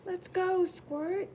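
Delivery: high, loud call out; fire chief calling. Acted. Fire chief calls the engine to go, loud high call. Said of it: "the fire chief calls".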